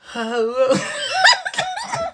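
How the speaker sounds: loud, very high squealing laugh; big laugh. Real, not acted. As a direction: burst out with a shrill laugh. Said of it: "I don't know why I laugh like that".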